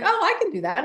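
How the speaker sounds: client's realization. Retold or imitated. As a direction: lightly mimic someone suddenly getting it.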